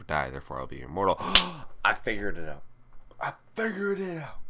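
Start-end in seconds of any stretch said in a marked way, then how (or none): 1.2-1.8 loud, high sharp gasp; mock gasp. Vlogger faking a eureka moment, loud high gasp. 1.8-4.4 hammed-up triumphant discovery, second one higher; mock eureka. Vlogger announcing his immortality plan with mock triumph.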